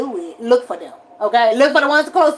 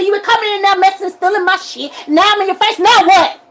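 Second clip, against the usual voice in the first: higher and louder, building to a shout; retold angry face-off; angry confrontation; 7 semitones higher, 6 dB louder than usual. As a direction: get in their face and escalate. Confronting family to their face.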